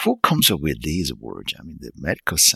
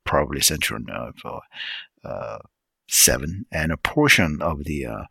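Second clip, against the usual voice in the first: ordinary delivery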